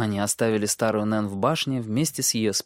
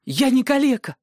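put-on hurt boy's cry; 14 semitones higher, 5 dB louder than usual. Bran hurt, near tears.

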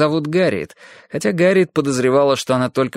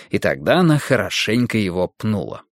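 ordinary delivery

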